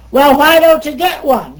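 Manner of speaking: loud, high mocking challenge; gruff retort. Congressman taunting the woman lobbyist, loud and high. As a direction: bark it back at her like an old bear. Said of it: "he said".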